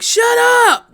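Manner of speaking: yelled from a window; furious neighbor shouting; angry shout. Retold or imitated. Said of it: "that one motherfucker in the apartment that's like".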